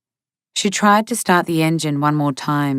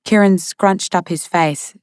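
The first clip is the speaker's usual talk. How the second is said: plain reading voice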